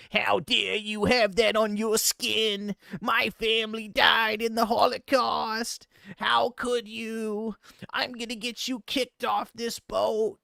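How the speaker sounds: hammed-up outrage at a tattooed stranger; mock outraged old man. Streamer mimics the old man scolding the tattooed guy, mock outrage. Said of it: "and he's like".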